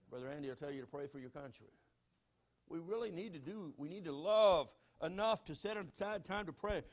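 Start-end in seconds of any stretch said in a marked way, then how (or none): none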